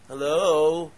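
loud, drawn-out "hello?"; incredulous, attention-grabbing call; mock incredulous. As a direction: call out as if nobody is home.

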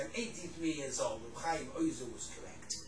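low, quiet retold conclusion; sombre admission. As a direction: imitate an old man softly conceding the truth. Old man's sombre conclusion, retold.

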